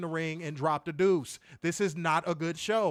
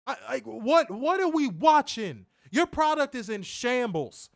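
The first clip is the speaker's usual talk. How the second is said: heated rant; 7 semitones higher, 4 dB louder than usual. Real, not acted.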